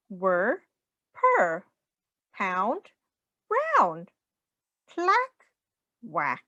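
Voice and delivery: bouncy rhyming storybook voice, swinging up and down; sing-song read-aloud. Acted. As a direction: read it like a playful rhyme for a small child. Reading rhyming picture book aloud.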